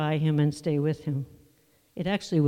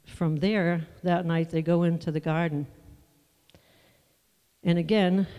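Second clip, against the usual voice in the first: ordinary delivery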